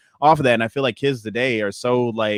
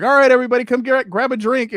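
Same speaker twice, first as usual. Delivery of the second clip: put-on high, loud call to children; calling teacher voice; 12 semitones higher, 4 dB louder than usual. Daycare teacher calling kids.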